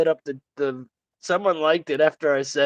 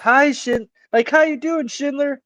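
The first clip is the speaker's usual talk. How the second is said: nice voice to women; 9 semitones higher than usual. Retold or imitated. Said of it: "Like that".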